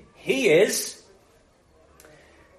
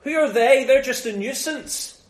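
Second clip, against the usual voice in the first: dismissive; 6 semitones higher, 3 dB louder than usual. Retold or imitated. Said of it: "have the attitude of".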